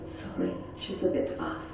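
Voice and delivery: hushed, conspiratorial aside to the room; whispered aside. Retold or imitated. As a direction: lean in and whisper it. Husband quietly tells the patients.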